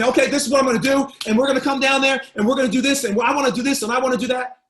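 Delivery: rapid-fire and loud; fast pushy Northern salesman pace; fast, loud talker. Shown as a demonstration. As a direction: rush every word, pushing hard. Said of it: "talking like this real quick and fast".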